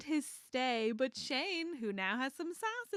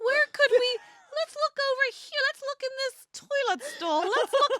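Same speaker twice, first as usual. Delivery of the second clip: higher, louder, hammed-up searching voice; mock Hayden search; 7 dB louder than usual. Mimicking Hayden searching the bathroom for a man, high mock voice. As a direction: play Hayden hunting stalls for a nice dude, hammed-up.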